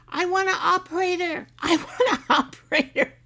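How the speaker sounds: hammed-up husband demanding a human, laughing through it; imitating husband. Mimicking husband fed up with phone robots, loud mock demand. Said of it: "he, um".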